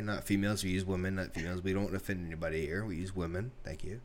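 very soft, low, measured reprimand; hushed mock correction. Correcting a friend's word for females, hushed and low. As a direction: drop right down and correct them discreetly.

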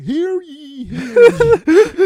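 high, loud proclaiming call; town crier. Retold or imitated. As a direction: announce it like a medieval crier. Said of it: "people walking around and be like".

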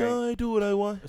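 high loud mocking voice. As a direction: squeak it out in mockery. Same mocking voice.

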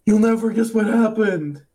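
put-on high eager kid voice. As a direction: blurt it like a thrilled little boy. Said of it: "he's like".

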